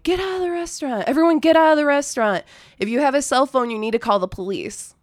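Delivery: put-on shouting an alarm over a drunk crowd; urgent yell. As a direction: order the whole room out, fast and high. Yelling at crowded restaurant to evacuate.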